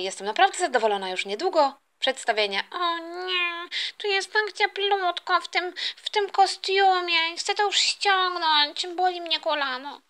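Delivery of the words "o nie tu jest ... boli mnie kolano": high, drawn-out whine; complaining costumed performer.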